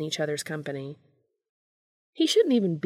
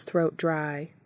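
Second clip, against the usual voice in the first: ordinary delivery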